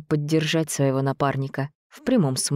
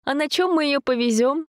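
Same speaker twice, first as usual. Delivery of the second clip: put-on girl voice; 12 semitones higher than usual. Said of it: "Даша рассмеялась".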